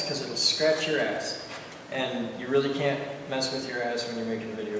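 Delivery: usual speaking voice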